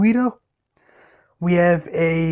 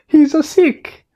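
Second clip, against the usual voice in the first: put-on high, loud, slow Italian-accented cry; exclaimed Italian accent; 11 semitones higher, 6 dB louder, slower than usual. Luigi bursting in on the cars at Flo's cafe, exclaimed Italian accent. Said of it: "Luigi exclaimed".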